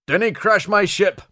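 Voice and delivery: high, loud bellow. Acted. Commander bellowing over the wind at the pilot. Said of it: "bellowed Douglas over the wind noise".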